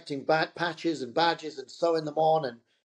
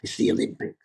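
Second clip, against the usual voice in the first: whispered and much lower; secretive whisper; 8 semitones lower, faster than usual. Retold or imitated. [name] finally revealing the Olympics, whispered. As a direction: breathe it like a big secret. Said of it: "He goes".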